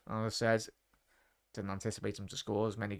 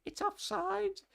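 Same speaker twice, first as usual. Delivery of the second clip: much higher mock commentator call; imitating Martin Tyler; slower than usual. Streamer mocking the TV commentator's offside call, high mock voice. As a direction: squeal it like a pundit sure of offside. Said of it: "Martin Tyler was like".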